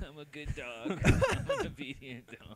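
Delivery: laughing through a silly slow pet voice; laughing mock servant. Co-host riffs on the joke, laughing. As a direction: giggle out the obedient-dog line.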